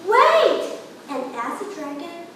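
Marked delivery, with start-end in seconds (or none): 0.1-0.8 high, loud call of wait; shout. Acted. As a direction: stop the dragon with a cry. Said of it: "But Elizabeth shouted".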